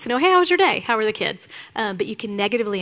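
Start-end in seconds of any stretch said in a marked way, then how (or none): 0.2-1.5 cheery greeting. Retold or imitated. Husband's real friendly question.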